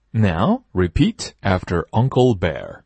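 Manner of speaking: slow, clear teacher prompt; slow classroom repeat-after-me prompt; slow teacher drill. Shown as a demonstration. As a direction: lead the class slowly. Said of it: "teacher leading drill".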